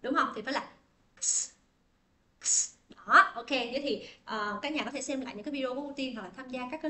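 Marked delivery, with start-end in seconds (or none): 1.2-2.7 "x" as voiceless "ks" without a vowel; isolated k-s cluster; joined k-s sound. Shown as a demonstration. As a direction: release k straight into s for "x". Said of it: "phải nói ra là âm cờ sờ".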